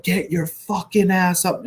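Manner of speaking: high, loud mock sergeant's yell; retold screaming order. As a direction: imitate a sergeant screaming at his men, drawn out. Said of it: "he starts screaming".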